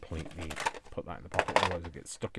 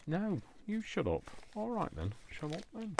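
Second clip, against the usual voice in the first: mock squabble; 12 semitones higher than usual. Mock argument with Dave.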